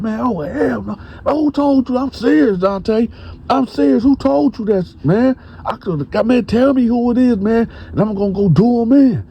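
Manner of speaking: very high, frantic voice, pitch way up; hooting and hollering. Retold or imitated. Bone demands a name from Dante, shrill and frantic. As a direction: overact outrage to cover guilt. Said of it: "just putting on a whole show".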